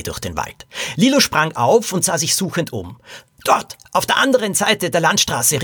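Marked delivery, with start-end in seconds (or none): 3.4-5.6 gerufen. A narrator's reading. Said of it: "rief sie".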